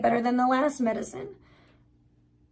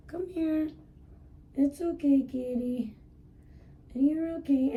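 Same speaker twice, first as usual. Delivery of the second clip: higher, soft comforting coo; soothing cat talk; 5 semitones higher, 4 dB quieter than usual. Real, not acted. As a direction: reassure the kitty tenderly.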